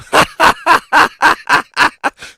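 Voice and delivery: high, loud burst of laughter; big laugh. Real, not acted. Comic cracks up at his own Jesus joke, loud laughter. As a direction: crack up at your own punchline.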